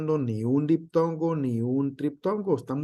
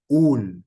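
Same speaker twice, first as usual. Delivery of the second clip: "ul" hit louder as the strong syllable; acento en úl; 7 dB louder than usual. Shown as a demonstration. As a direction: punch "ul" as the stressed syllable. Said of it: "el acento". ("ul" fuerte, con el acento)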